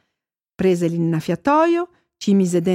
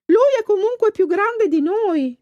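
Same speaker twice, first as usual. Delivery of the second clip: high little fish voice, scolding; gilthead voice; 12 semitones higher than usual. A narrator's reading. Gilthead scolding the pike in a high voice. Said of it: "disse l'orata".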